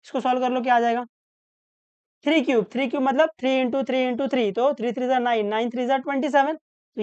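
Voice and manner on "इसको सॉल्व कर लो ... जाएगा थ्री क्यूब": normal talking voice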